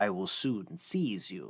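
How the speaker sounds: low, soft, slow threat; menacing fiend. Acted. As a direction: threaten quietly as an old fiend. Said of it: "said the fiend".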